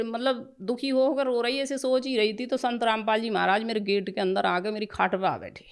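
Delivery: ordinary talk, nothing marked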